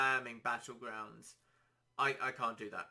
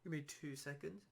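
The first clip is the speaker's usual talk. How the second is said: losing voice; 3 semitones higher, 5 dB quieter than usual. Real, not acted. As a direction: voice going, needs a break. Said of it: "I'm losing my voice a bit".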